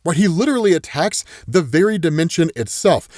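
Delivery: ordinary delivery, nothing marked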